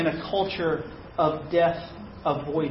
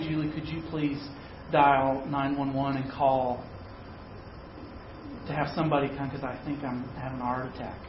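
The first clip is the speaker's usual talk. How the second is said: soft, calm and steady so as not to alarm; 4 semitones lower, 9 dB quieter, faster than usual. Retold or imitated. Husband asking Julie to dial 911, trying to sound calm. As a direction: keep it even and gentle to avoid scaring her. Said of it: "try in a very calm voice".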